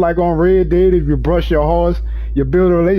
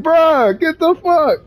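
mock exasperated yell; 11 semitones higher, slower than usual. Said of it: "I'm like".

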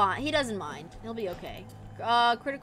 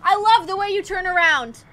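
nervous mock praise; 5 semitones higher, 8 dB louder than usual. Real, not acted. Mocking praise to monster.

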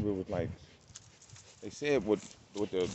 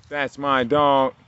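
louder, higher and slowly drawn out; slow proud praise; 4 semitones higher, 8 dB louder, much slower than usual. Real, not acted. Owner praising his dog, slow and drawn out. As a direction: stretch every word like a proud owner.

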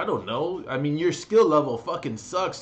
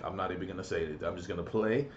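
muttered to himself; 7 semitones lower, 9 dB quieter, faster than usual. Retold or imitated. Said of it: "I'm like".

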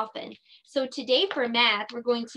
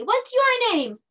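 "What's your name?" called out high and loud; yelling; 13 semitones higher, 5 dB louder than usual. Shown as a demonstration. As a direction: call it out loud to the class. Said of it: "yell out your name".

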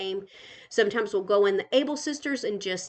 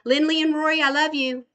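calling out fondly; 6 semitones higher, 6 dB louder than usual. Real, not acted. Grandma calling to her grandkids in the other room, loud fond call.

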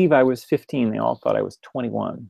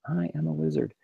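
"I am a wizard" murmured much softer, barely voiced; under your breath; 3 semitones higher, 9 dB quieter than usual. Shown as a demonstration. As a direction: say it quietly to yourself, almost under the breath. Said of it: "even if you say it under your breath".

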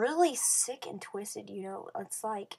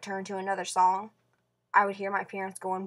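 normal talking voice

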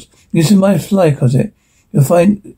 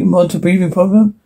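ordinary talk

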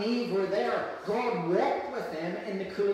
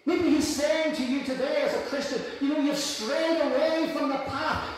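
raised, fast, pleading sermon; impassioned preaching; 3 semitones higher than usual. Acted. Pastor calling straying Christians back, impassioned. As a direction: call the strays home.

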